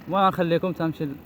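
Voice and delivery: ordinary talk, nothing marked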